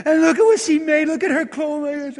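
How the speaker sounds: high, hammed-up blubbering widow; mock weeping. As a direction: wail the words as if bawling. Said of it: "they're all crying".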